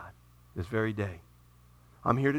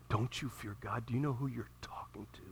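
softer, whispered rebuke; hushed rebuke; 5 semitones lower, 12 dB quieter than usual. Acted. Repentant thief rebuking the other, hushed whisper. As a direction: hiss it low to the other thief.

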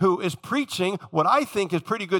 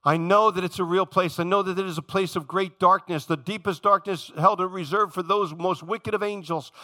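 ordinary talk

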